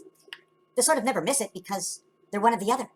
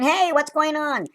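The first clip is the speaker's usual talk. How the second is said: high, loud, slow mock call; playful hammed-up holler; voicing the figure; 10 semitones higher, 6 dB louder, slower than usual. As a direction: shout it out slowly as the little man. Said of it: "He's going".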